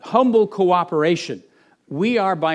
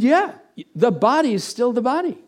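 emphatic reassurance; 7 semitones higher, 4 dB louder than usual. Retold or imitated. Pastor's retold answer to woman.

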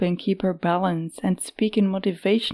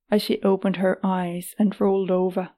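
plain reading voice

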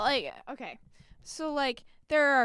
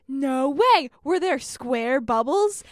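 loud, drawn-out imitation of disbelief; mock-amazed, leaning on each word; astonished; 3 semitones higher, 10 dB louder, slower than usual. Imitating sister asking about the shared dream, loudly astonished.

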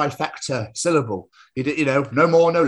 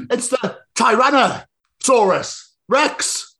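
slow, high dramatic announcement; impactful intro; 13 semitones higher, 6 dB louder, much slower than usual. Acted. Tom announces a dinosaur for a big start, slow dramatic. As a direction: stretch it out like a trailer. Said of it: "Make it impactful".

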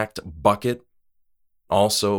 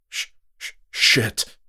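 hushed stutter bursting into a louder curse; startled stammer; 3 semitones higher, 4 dB louder than usual. A narrator's reading. Freezing man startled by a tug.

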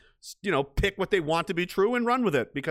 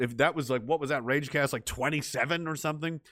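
ordinary talk, nothing marked

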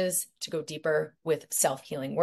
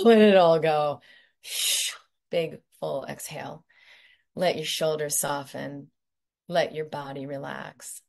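guided meditation. Acted. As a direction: lead them gently through each point.

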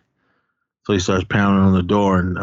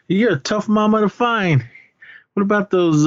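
high-pitched mock taunt; imitated taunting creditor; 12 semitones higher than usual. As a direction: play the creditor, voice pitched well up. Imitates money lender confronting Cruz.

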